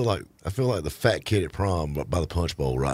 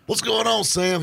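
excited greeting. Retold or imitated. Host voices himself greeting friend.

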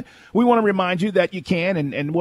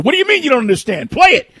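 louder, rising to an exasperated yell; arguing with Alexa; 7 semitones higher, 5 dB louder than usual. Retold or imitated. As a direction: argue back at the speaker. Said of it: "argue with her".

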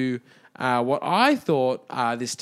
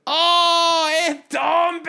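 high, loud mock-French realisation; French accent; 6 dB louder, much slower than usual. Ticket seller finally understands the town, mock French shout. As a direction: exclaim it as an exaggerated Frenchman. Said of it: "in his French accent".

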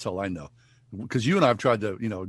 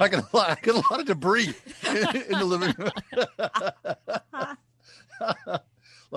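laughing; 7 semitones higher than usual. Real, not acted. Joking about debris while laughing.

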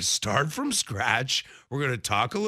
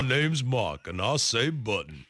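Texas twang imitating co-host; mock Texas accent; 3 semitones lower than usual. Host impersonating co-host saying button, mock Texas accent. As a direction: tease with a put-on Texas accent. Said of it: "Why am I from Texas".

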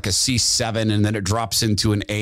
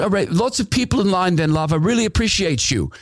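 raised, friendly put-on voice; Glenn wrapping up; 9 semitones higher than usual. Glenn telling Todd there's a line, in a raised friendly voice. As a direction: ease the fan off kindly, pitched up. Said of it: "He says".

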